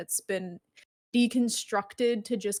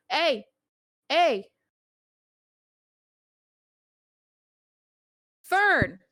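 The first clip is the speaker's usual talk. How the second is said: short, raised calls across a busy dock; reluctant yell; 6 semitones higher, 6 dB louder, much slower than usual. Acted. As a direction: call out loud and higher to get someone's attention. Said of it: "but he's gonna yell".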